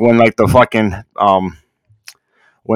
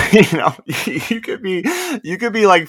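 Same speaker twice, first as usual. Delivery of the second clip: laughing; 9 semitones higher, 5 dB quieter than usual. Real, not acted. Cracking up at own joke.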